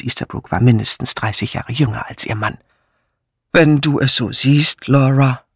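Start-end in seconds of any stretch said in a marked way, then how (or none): 3.5-5.4 repeated, pompous colonel. Acted. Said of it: "wiederholte er".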